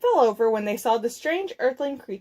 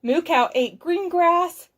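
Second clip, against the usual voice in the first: ordinary delivery, nothing marked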